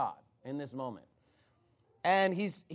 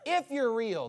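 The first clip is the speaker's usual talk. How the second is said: mock-spiteful challenge, pitched up; a touch of spite, raised voice; 5 semitones higher, 4 dB louder than usual. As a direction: throw down a grudging dare. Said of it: "being spiteful".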